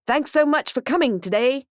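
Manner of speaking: lightly voiced cheery robot puppy; mascot voice. A narrator's reading. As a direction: greet the kids like a kiddie-show mascot. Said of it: "robot mascot greeting crowd".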